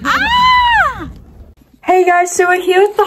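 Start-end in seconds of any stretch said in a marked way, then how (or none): 0.1-1.1 high, loud thrilled shriek; excited scream. Real, not acted. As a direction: let out a joyful scream. Screams after booking flights.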